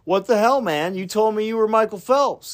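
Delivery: retold indignant complaint, loud and high; pissy. Let-down fan confronting the man he misidentified, pissy. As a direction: act out an annoyed fan's loud accusation. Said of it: "the guy got all pissy".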